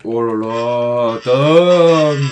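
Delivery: slow oh là là then loud Tom; delighted shout. Real, not acted. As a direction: cheer your teammate by name. Delighted at teammate play. (ravi, crie Tom)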